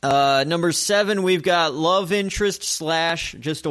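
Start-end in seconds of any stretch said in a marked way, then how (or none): none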